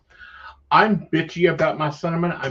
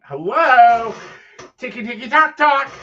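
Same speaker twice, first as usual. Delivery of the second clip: high, louder sing-song spirit voice; hammed-up playful haunting chant; spooky haunting greeting; 7 semitones higher, 7 dB louder than usual. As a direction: tease them like a playful ghost. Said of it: "start perking your coffee going".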